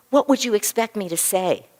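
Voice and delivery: loud, put-out comeback as if the doctor asked too much; indignant retort. Retold or imitated. Patient firing back at her doctor, loud and indignant. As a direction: throw the question back at her, affronted. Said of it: "Just like this".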